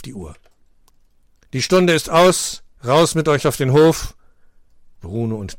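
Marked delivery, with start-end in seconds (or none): 1.5-4.2 higher, louder teacher calling time; lightly voiced teacher, louder. A narrator's reading. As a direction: send the class out to the yard. Teacher dismissing class.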